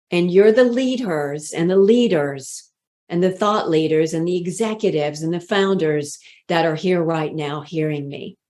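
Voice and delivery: ordinary talk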